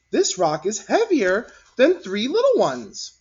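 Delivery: put-on a kid proudly explaining; child voice. A narrator's reading.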